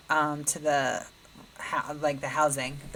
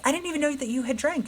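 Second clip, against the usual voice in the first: much higher and fast, incredulous; 11 semitones higher, faster than usual. Retold or imitated. Daughter stunned that her sober friend was drinking. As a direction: blurt it out in shocked disbelief.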